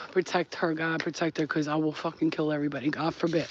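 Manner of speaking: quiet menacing vow; low intense vow. Real, not acted. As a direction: murmur the threat under your breath. Fierce prayer for her dog.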